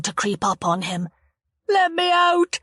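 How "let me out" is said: high, loud terrified scream; trapped boy screaming at the bully, high and loud. A narrator's reading. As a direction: scream it at the locked door. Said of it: "he screamed".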